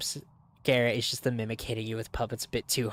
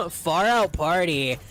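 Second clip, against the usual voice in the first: stoner voice; 3 semitones higher than usual. Retold or imitated. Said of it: "the stereotypical stoner voice".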